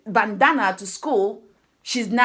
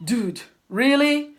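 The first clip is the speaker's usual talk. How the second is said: disbelieving dude; 2 semitones higher, slower than usual. Retold or imitated. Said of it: "I'm like thinking".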